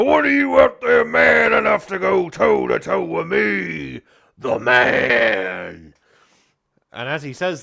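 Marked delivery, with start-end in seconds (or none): 0.0-5.9 slow booming showman's proclamation; wrestler boast. Acted. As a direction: announce yourself as the main event. Wrestler challenges the crowd.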